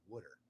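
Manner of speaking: "wooder" with the vowel of "wood"; Delaware pronunciation. Shown as a demonstration. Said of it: "break the habit of saying".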